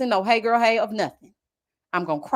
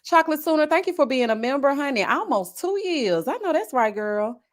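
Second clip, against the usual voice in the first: ordinary talk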